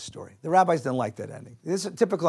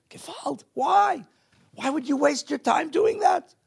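shocked outcry; 11 semitones higher, slower than usual. Acted. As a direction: cry out in horror at wasted time. Shocked rabbi voiced in sermon.

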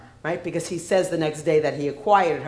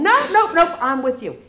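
squealed much higher and louder; bratty little kid; 10 semitones higher, 8 dB louder than usual. Retold or imitated. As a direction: whine it like a clingy child. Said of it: "It's all about tonation".

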